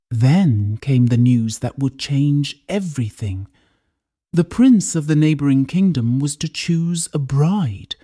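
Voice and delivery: plain reading voice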